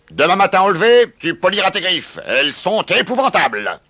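loud, high inspection reprimand; shouted scolding. A narrator's reading. Rooster scolds a hen at inspection, shouting. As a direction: shout the order and the criticism. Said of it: "Puis il cria à Prunette".

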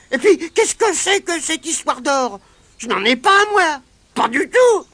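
very high, loud whiny little creature; indignant leprechaun. Acted. Captured leprechaun protests to Pat, very high and whiny. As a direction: protest like a tiny outraged goblin.

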